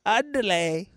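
light mock Mexican accent as Speedy Gonzales; hint of a cartoon Mexican mouse. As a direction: rattle off andale like Speedy Gonzales. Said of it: "he's like".

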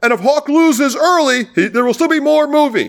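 high announcer voice stressing still; mock announcer. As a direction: play the MC reminding the crowd again. Continuing the announcer parody.

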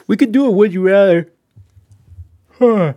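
words stretched by a yawn. Real, not acted. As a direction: talk through a big yawn. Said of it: "Sorry, I'm yawning at you guys".

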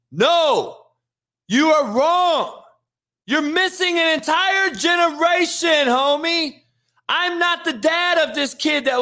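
a streamer fed up with a commenter's claim; heated rebuttal. Real, not acted. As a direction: shout the correction down. Rebutting a chatter angrily.

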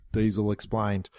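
plain reading voice

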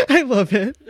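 high, loud burst through laughing; helpless, squealing laugh; real laughter. Real, not acted. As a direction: crack up as you say it. Cracks up at co-host's remark.

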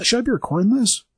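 high, lightly voiced bystander; onlooker's quip. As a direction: toss it off as a nervous onlooker. Bystander quoted during the fight.

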